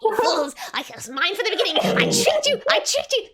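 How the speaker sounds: high, shouted villain rant; manic, loud little trickster crowing; gloating Boggle. Acted. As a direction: screech in frantic triumph, very high. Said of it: "fucking Boggle just swings down".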